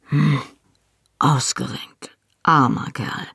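terse grunted verdict in a character voice; grunting. A narrator's reading. Leader examines the injured lad's shoulder, grunting. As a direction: grunt it out between pauses. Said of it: "Dougal grunzte".

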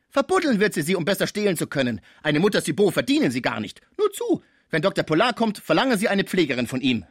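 agitated, high-pitched warning tirade; scolding porter's wife. Acted. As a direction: rattle off dire warnings in an offended huff. Cibot scolding about a nurse. (aufgebrachte, zeternde Pförtnersfrau)

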